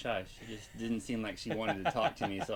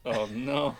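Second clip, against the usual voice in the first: loud, raised mock alarm; higher, louder acted protest; retold dismay; 4 semitones higher, 8 dB louder than usual. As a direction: call it out in playful dismay. Retelling own dismayed reply.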